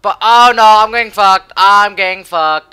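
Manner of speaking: high, loud panicked cry; alarmed yelling while dying. Real, not acted. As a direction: shout as you get caught. Being killed in game.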